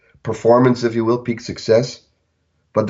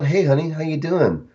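tepid greeting; 5 semitones higher, 3 dB quieter than usual. Retold or imitated. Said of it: "this tepid".

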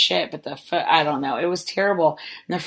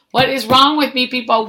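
exasperated self-reproach; 8 semitones higher, 9 dB louder than usual. Real, not acted. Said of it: "self-reproach, rising".

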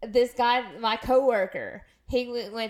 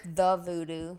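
lightly mocked Louisiana accent, slowly; mimicked TV Cajun accent; 3 semitones lower, slower than usual. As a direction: imitate a Cajun TV accent on "the voodoo". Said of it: "But they say, he says".

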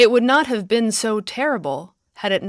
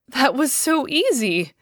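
higher, louder joyful exclaim; delighted exclaim; 5 semitones higher, 5 dB louder than usual. A narrator's reading. Woman amazed the thing vanished, delighted exclamation. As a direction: burst out in delight. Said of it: "exclaimed the woman, delighted".

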